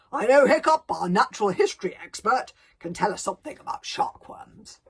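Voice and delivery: loud commander's address, easing off; booming. Acted. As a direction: call on the expert in a big voice. Gobber goes on booming at boys.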